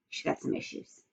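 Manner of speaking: hushed, unvoiced comment; whispered aside. Real, not acted. Teacher whispers a comment to the class mid-story. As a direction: whisper it under your breath.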